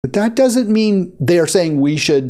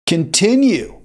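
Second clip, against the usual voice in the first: high, sarcastic mock cheerleading; mocking AIPAC; 8 semitones higher than usual. Host mocking AIPAC's message, sarcastic and high. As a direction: mimic a lobby egging Israel on, higher. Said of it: "which is saying".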